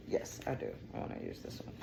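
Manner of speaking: very soft whisper to oneself; whispered aside. Real, not acted. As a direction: whisper your own answer. Answers own question under breath.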